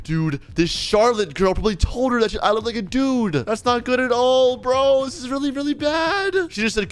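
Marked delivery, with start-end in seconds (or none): 3.3-6.3 higher, mounting worry; alarmed. Pretend. Player fearing his disguise is found out, alarmed. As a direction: play up the dread, pitch climbing.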